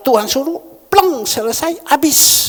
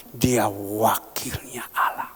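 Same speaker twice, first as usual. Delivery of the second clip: solemn whisper; 9 semitones lower, 5 dB quieter, slower than usual. Real, not acted.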